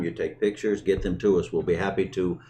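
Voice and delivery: ordinary delivery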